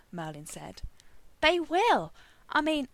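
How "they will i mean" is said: louder, much higher, emphatic rebuttal; insistent. A narrator's reading. Arthur countering Merlin's doubt, insistent. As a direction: jump in and insist.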